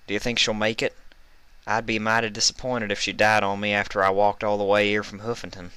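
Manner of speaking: lightly voiced country mare, concerned; worried farm pony, light Southern accent. A narrator's reading. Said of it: "came a concerned voice, caked with a heavy accent".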